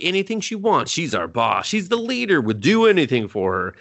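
lightly acted gushing, leaping high in pitch; mock eager devotion. Party pledging loyalty to their leader, mock eager devotion. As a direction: chime in like eager followers.

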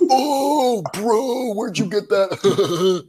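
high, drawn-out stunned reaction; mock amazed realisation. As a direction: imitate a drunk listener finally getting it. Acting drunk listener finally getting joke.

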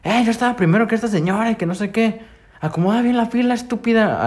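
high-pitched mock shout at the guard; imitating an angry yell. Old man yelling at the security guard in line, mock angry shout. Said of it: "uno empieza a gritar".

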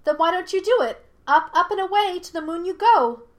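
high, loud, playful taunt; taunting monkey spirit. A narrator's reading. Monkey spirit mocks Yue's mission, high and loud. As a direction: send her off mockingly.

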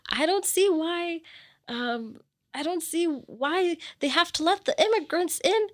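high, emphatic imitation of a political complaint; opinionated old lady. Conservative neighbor giving opinions on immigrants, high mock complaint. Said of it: "giving me her opinions".

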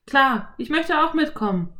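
put-on a clever boy explaining; boy Philipp. A narrator's reading. Philipp voiced. (Jungenstimme, erklärend)

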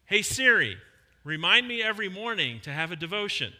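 loud, clear command to the phone; calling out to the phone. Shown as a demonstration. Pastor demos talking to his iPhone, loud command. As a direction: call it out so Siri hears you. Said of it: "Pull out your iPhone and say".

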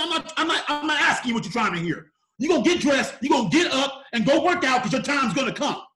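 loud, high imitation of mom laying down the law; insistent mom. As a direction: raise your voice as a mother out of patience. Mom repeats order, out of patience.

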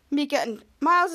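plain reading voice

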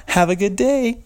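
very high, sugary mock of her goodbye; mocking cheery lady. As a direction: squeak it out like a fake-friendly coworker. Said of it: "turn around, smile".

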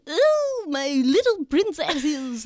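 high, slow, hammy villain croon; evil queen. Retold or imitated. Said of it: "an evil queen character voice".

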